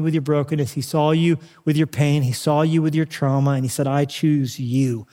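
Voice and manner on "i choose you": slow, tender declaration. Acted. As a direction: stretch each word slowly and tenderly. Father's choosing words, drawn out.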